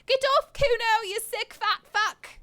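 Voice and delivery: high, loud fake cry for help; bratty kid yelling. Kuno yelling to passersby, high mock alarm. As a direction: shriek it like a brat faking distress.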